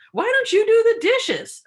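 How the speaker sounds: loud, high, slow snap back; defensive retort. Shown as a demonstration. Demo of a defensive partner, loud high retort. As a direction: fire the blame right back. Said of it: "defensiveness would be the other person responding with".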